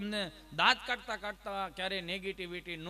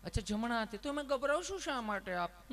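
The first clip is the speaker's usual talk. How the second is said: lightly voiced nurse, higher and kind; a hint of a sugary nurse, pitched up; sweet nurse; 4 semitones higher than usual. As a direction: echo the answer sweetly like a nurse. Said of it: "તમે કેટલું પ્રેમથી પૂછો છો".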